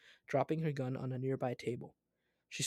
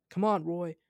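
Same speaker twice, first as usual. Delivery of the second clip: calling out loudly; shouting; 4 semitones higher, 7 dB louder than usual. A narrator's reading. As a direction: yell it across the gap. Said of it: "Jasper shouted".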